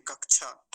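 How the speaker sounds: कक्षा loud as kak-chhaa; chh for ksh. Shown as a demonstration. Teacher showing the wrong pronunciation, chh for ksh. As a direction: replace sha with chha in कक्षा. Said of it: "वो गलत उच्चारण है।".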